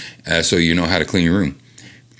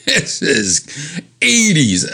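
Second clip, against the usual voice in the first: slow, drawn-out mock verdict; wry slow comment on eighties fashion; amused drawl; 8 semitones higher, much slower than usual. Real, not acted. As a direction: savor the cheesiness slowly. Mocking retro magazine drawing.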